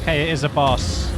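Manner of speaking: suddenly alarmed it's a boss. Real, not acted. Boss fight suddenly starts.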